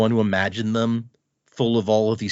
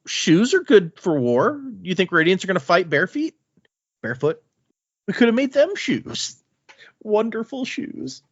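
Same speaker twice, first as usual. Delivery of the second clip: a hint of a fussy spren pleading for shoes; mild put-upon spren voice, higher pitch; Wendell voice; 7 semitones higher than usual. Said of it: "And he's like".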